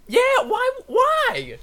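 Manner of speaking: very high-pitched incredulous cry. Real, not acted. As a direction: demand an explanation in high disbelief. Incredulous at the thief's choice.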